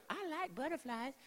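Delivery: put-on high little-girl voice; tiny, high-pitched kid voice; Lydia. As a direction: imitate a small child piping up. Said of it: "And Lydia goes".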